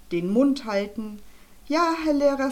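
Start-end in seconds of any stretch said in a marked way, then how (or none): 1.7-2.5 put-on higher, dutiful chant of drilled children; obedient pupils. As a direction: recite it like a cowed schoolboy. Pupils drilled to obey teacher.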